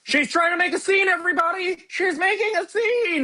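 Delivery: very high-pitched imitation of a kid; bratty kid outburst. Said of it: "the kid was like".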